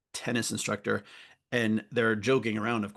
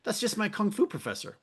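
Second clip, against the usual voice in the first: mock blasé; 9 semitones higher than usual. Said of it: "but I'm like".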